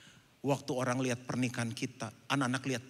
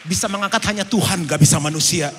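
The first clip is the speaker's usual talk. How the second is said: fervent preaching; 5 semitones higher, 15 dB louder than usual. Real, not acted. Preaching climax.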